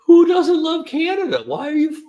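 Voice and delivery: high, disbelieving outburst; incredulous. Retold or imitated. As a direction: sound baffled anyone would. Incredulous retort to buddy.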